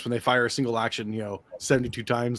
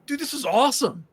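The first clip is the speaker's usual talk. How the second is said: high, thrilled movie-fan gush; retold excitement; 11 semitones higher than usual. Lone fan telling unimpressed friends after the movie, high-pitched and excited. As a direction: gush in a much higher voice, mimicking your own delight.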